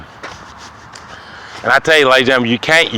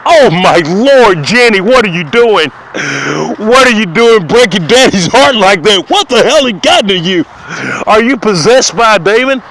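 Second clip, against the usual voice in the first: outraged daddy; 8 semitones higher, 4 dB louder than usual. Retold or imitated. Daddy shrieking at his daughter Jenny, high and outraged. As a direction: screech it like a scandalized dad. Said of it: "And you was like".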